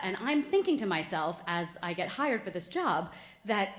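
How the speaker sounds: ordinary talk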